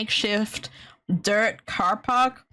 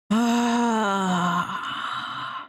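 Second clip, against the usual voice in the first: drawn-out exhale; heavy, exasperated sigh; long weary sigh; 2 semitones lower than usual. Real, not acted. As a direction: sigh it out at length. Grumbling about a sleepless night.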